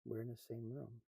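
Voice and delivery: soft de-escalating tone in a quarrel; calm quiet voice. Retold or imitated. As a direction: defuse the argument, speaking softly. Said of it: "start talking real quietly during an argument".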